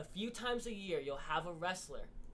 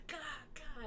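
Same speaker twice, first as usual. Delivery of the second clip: mock grunts; 10 semitones higher, 6 dB quieter than usual. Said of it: "just like".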